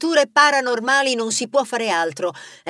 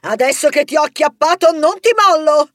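much higher, louder defiant shriek; 7 semitones higher, 6 dB louder than usual. Acted. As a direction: yell while clinging on.

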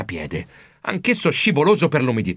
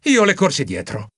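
plain reading voice

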